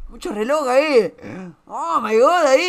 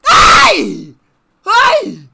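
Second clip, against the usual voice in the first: loud yelps, the second much higher; shocked yelps; 7 semitones higher, 12 dB louder than usual. Real, not acted. Reacting to a clip on stream, loud shocked yelps. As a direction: yelp twice in shock.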